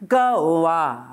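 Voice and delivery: low chest tone, relaxed; low relaxed sung phrase. Shown as a demonstration. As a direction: drop into your low voice, total chill. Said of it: "you in your low voice".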